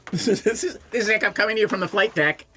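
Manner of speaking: quick pilot intercom impression; fast mock flight deck call; pilot cockpit headset. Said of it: "preface everything with".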